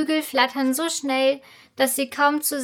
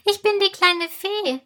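high, tiny piping voice; a little fairy calling out, high and small; squeaky fairy voice; 8 semitones higher than usual. A narrator's reading. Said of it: "antwortet die piepsige Stimme".

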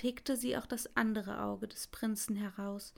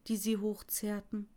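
ordinary delivery

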